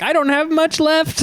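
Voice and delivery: put-on slow, high-pitched old person voice. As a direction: plead slowly and high, like someone old. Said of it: "And they're old, so they're like".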